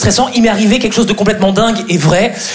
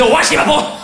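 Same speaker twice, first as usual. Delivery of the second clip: angry fake Chinese; 8 semitones higher than usual. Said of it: "Le chinois énervé".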